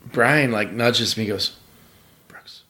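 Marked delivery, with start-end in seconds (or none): 2.3-2.6 hushed, slow, awestruck whisper. Retold or imitated. Brian nudging his friend about Bobby Orr, whispering. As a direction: whisper it like a secret. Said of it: "Why are you whispering?".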